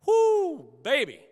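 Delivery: loud, high whoop of joy; exultant whoop. Real, not acted. A pastor exulting to the church after a healing story, loud and high. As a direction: let out a big celebratory whoop.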